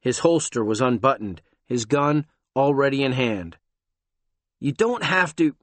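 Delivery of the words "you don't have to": nervous. Acted. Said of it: "Tom started nervously".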